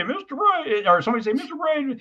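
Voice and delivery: high, squeaky teasing voice; funny mock voice. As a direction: pipe it up like a kid heckling the teacher. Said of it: "in this kind of funny voice".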